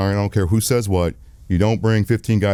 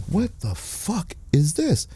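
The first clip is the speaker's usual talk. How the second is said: stunned; 9 semitones higher, 5 dB quieter, much slower than usual. Retold or imitated. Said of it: "And I'm like".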